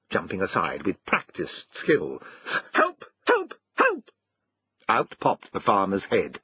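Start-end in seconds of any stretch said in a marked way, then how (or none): 2.7-4.1 very high squealed cries; three short, urgent yelps for help; dog calling for help. A narrator's reading.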